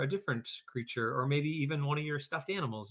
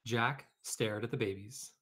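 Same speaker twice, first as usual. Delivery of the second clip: ordinary delivery, nothing marked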